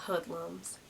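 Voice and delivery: muttered low and soft; a low, soft mock mutter of the insult; imitating a woman sneering under her breath. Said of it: "underneath her breath said".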